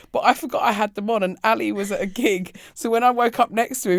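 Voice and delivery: normal talking voice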